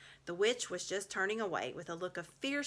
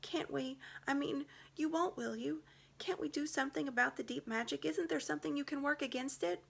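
put-on higher pleading girl voice; 9 semitones higher than usual. A narrator's reading. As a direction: Susan begging the lion to help. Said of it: "whispered Susan in the lion's ear".

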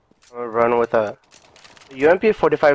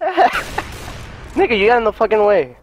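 angry yell; 8 semitones higher, slower than usual. Real, not acted. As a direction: yell at the teammate in the way. Teammate blocks his shot.

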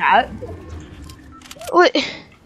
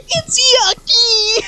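mock whiny quote; 5 semitones higher, much slower than usual. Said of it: "all I hear is".

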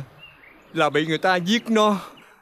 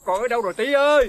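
calling out; 4 semitones higher, faster than usual. Acted. Father searching for lost son.

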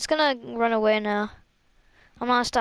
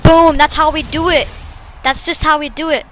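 excited cheer; 4 semitones higher, 6 dB louder than usual. Real, not acted. Celebrating a win.

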